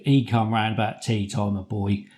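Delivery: ordinary talk, nothing marked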